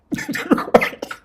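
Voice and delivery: a breathy high-pitched laugh; amused high giggle. Real, not acted. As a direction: burst into a squeaky laugh. Laughs at the punchline.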